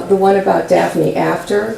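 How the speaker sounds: ordinary talk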